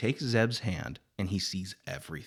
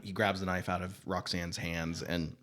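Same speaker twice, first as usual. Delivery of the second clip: ordinary delivery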